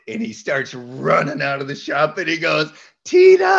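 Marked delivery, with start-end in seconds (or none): 3.0-3.4 loud, high yell of a name; calling out. Retold or imitated. Coworker yells who is on the phone, a loud high call. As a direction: shout across the shop.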